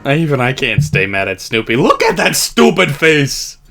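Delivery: normal talking voice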